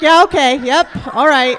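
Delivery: hammed-up turned-on agreement, belted out; mock aroused excitement. Acts out getting turned on watching.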